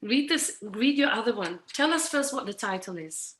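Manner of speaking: ordinary talk, nothing marked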